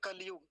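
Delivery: "कलयुग" with no इ after ल; dropped इ error. Shown as a demonstration. Teacher quoting what some people say, dropped इ. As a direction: say "कलयुग" in two beats, कल-युग. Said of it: "इकार को हटा के कलयुग कह देते हैं".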